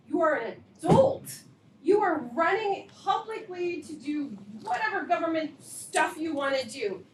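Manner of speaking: loud, high, indignant scolding; furious parent scolding the board president; angry. Real, not acted. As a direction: let the anger rise until it breaks out. Parent confronting board president angrily.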